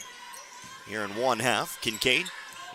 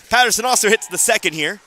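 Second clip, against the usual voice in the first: excited call; 8 semitones higher, 7 dB louder than usual. Real, not acted. Calling a free throw.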